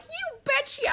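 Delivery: high, exaggerated Southern you betcha; mock Southern you betcha; Southern accent. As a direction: play up a Southern drawl. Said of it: "like, Southern".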